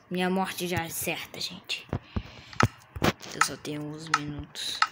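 hushed doomed whisper. Pretend. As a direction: whisper your certain doom. Whispered doom before fight. (sussurro de condenado)

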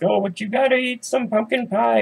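high coaxing auntie voice; pushy aunt. Retold or imitated. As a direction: sweetly push the pie on them like an aunt. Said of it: "your Aunt Nora's like".

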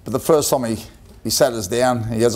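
ordinary delivery

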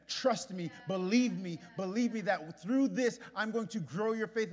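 building from speaking to a loud preached cry; preaching at full force. Acted. Preacher voicing Jesus to the congregation, building to a loud cry.

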